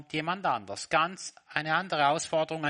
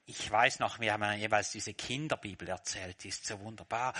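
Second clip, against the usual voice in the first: normal talking voice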